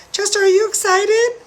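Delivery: high, rising, eager ask; excited question. Acted. Host asking puppet Chester, high and eager. Asks puppet, pitch leaps.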